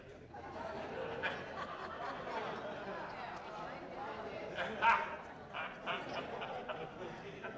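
hearty laugh at his own joke; long real laugh. Real, not acted. Preacher laughing at his own Louisiana joke, long and hearty.

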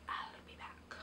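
whispered aside; quick whispered aside while stepping away. Real, not acted. Aside while leaving camera.

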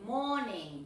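"morning" as "mo-ning", r dropped; correct silent R. Shown as a demonstration. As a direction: repeat "morning" smoothly with no r. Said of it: "no morning".

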